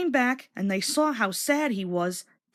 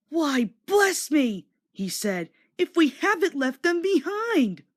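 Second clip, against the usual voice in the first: high, slow startled bless me; shocked little engine; dismayed surprise; 6 semitones higher than usual. Acted. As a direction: realise with a jolt. Thomas realises coaches are missing.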